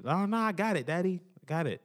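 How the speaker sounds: high-pitched imitation of a little boy; young son's voice. Imitates his young son refusing help.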